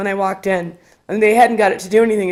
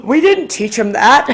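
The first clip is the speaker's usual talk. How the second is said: startled parents; 3 semitones higher, 4 dB louder than usual. Retold or imitated. Startled parents disown the remark.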